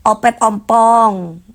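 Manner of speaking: Opet ompong drawn out slowly; mocking drawl. Real, not acted. To the live chat, slowly taunting Opet ompong. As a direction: savour the nickname as a taunt.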